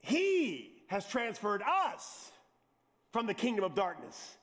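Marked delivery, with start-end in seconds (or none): 0.0-2.3 very slow emphasis. Acted. Slow emphatic reading of verse.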